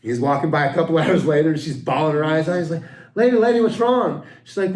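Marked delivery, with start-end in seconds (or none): 3.1-4.2 higher, lightly voiced worried lifeguard; concerned lifeguard. Joke teller voicing the lifeguard, a touch higher. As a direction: call out to the crying lady.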